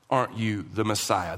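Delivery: low, quiet, falling line; statement of faith. Shown as a demonstration. Believer addressing the Messiah, in quiet faith. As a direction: say it simply, as if believing it. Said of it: "This isn't a statement of faith".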